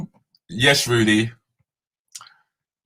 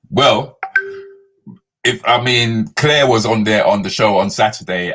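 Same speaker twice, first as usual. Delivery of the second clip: ordinary talk, nothing marked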